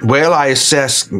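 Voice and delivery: ordinary talk, nothing marked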